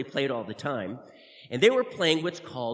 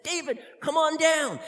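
higher, loud call down; 12 semitones higher, 5 dB louder than usual. Acted. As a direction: call up from below the ladder. Calling down from ladder.

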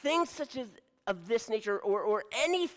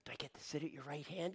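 fast, soft eager question; softer, quicker hopeful whisper; hushed eager hope; 6 semitones lower, 14 dB quieter, much faster than usual. Acted. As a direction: rush it out under your breath.

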